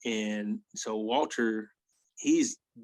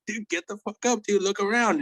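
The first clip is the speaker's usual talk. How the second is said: high, pressing, getting louder at the end; urgent wake-up; 9 semitones higher, 4 dB louder than usual. Retold or imitated. To sleeping Walt among strangers, urgent and high. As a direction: shake him awake with your voice.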